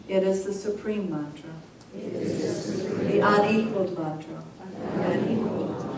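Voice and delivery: measured, solemn recitation of scripture, each line repeated; sutra recitation. Acted. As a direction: read each verse out steadily, then say it again.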